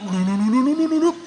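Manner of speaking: bubbly gurgle like a water jug; comic gallon glug noise; gurgling water sound. Shown as a demonstration. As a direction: blub the lips like a water gallon glugging. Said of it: "Kayak suara galon itu loh".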